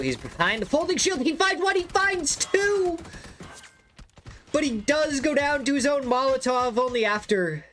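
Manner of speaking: very high, loud hyped shout; screaming play-by-play. Real, not acted. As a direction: scream the clutch kills. Caster screams a two-kill play.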